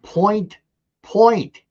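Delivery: Point, point, each high and on its own; emphatic repetition. Real, not acted. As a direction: hammer each "point" separately. Repeats the number for a listener.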